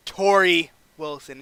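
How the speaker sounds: loud, slow reveal of the name; pick announcement. Acted. Eric Bischoff announcing his pick, loud reveal. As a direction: draw out the name loudly for the crowd.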